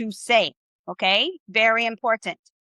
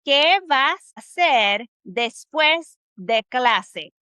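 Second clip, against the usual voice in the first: slow word by word Spanish; staccato; much slower than usual. Shown as a demonstration. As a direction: spell each word out slowly. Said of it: "This is how you say it in Spanish".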